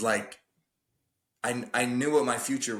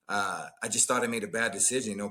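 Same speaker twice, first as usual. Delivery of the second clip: ordinary delivery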